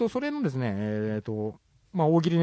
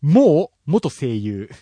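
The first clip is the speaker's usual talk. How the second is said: slow punchline; 7 dB louder, much slower than usual. Acted. Punchline delivery, drawn out.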